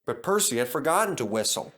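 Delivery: usual speaking voice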